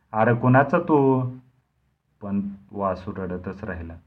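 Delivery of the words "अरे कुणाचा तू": slow, higher, louder threatening bark; threatening demand. Acted. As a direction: bark the question at the crying boy. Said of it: "दरडावून विचारले". (दरडावून, हळू आणि मोठ्याने)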